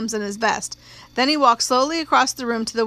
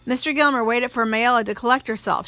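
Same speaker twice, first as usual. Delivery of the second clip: plain reading voice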